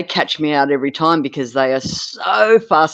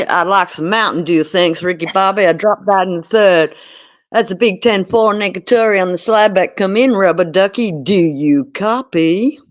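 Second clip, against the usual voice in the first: US accent impression; 4 semitones higher, 3 dB louder than usual. Impersonating Ricky Bobby's buddy for the stream, American accent. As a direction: do your best hammed-up American accent. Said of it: "That's my best U S accent".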